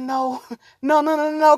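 ordinary delivery

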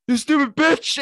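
high, loud mock-furious yell; comically sudden screamed insult; aggressive outburst. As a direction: explode at her in hammed-up rage. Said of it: "just immediately get aggressive".

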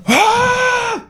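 high-pitched drawn-out yell; imitated lifting roar. Lifter shows his gym yell, imitated roar. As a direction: mimic a roar under a heavy weight. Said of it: "Og så sådan".

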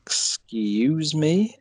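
drawn-out, incredulous question climbing high; mock disbelief. Viewer reacts to an unexpected Southern accent, mock disbelief. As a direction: stretch it out slowly, rising in mock disbelief. Imitates own baffled first reaction.

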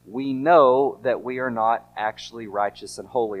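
ordinary talk

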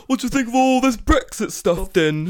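high, loud British caricature; exaggerated UK accent. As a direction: ham up the mocking British accent. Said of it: "your mocking, like, U K accent".

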